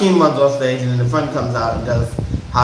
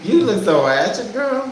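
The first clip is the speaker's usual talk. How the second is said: put-on sassy girl voice; 6 semitones higher than usual. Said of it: "It was like".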